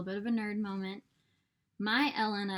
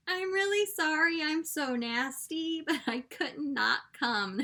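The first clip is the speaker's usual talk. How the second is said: apologetic; 8 semitones higher, 4 dB louder than usual. Retold or imitated. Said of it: "I show up and I'm like".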